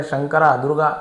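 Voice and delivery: ordinary delivery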